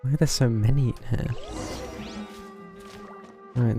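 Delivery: ordinary talk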